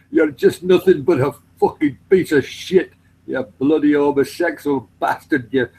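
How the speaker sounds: hammed-up Scottish insults; mock Scottish tirade. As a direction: imitate an abusive Scotsman, laughing through it. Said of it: "Just calling him".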